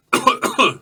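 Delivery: sharp high signal coughs; two quick coughs. Acted. Megan coughing a signal to the prisoner, sharp coughs. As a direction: cough twice as a secret signal. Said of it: "she lets out two quick".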